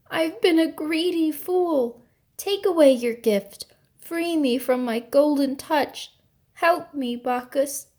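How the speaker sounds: desperate pleading. A narrator's reading. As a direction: plead for mercy in despair. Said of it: "he cried".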